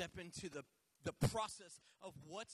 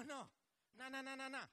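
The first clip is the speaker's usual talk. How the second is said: usual speaking voice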